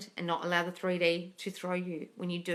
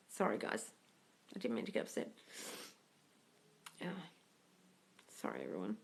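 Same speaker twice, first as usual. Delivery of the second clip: choked, quiet apology through real tears; trying to speak while breaking down crying; tearful, barely voiced sorry; 10 semitones lower, 12 dB quieter than usual. Real, not acted. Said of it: "I'm really sorry for crying".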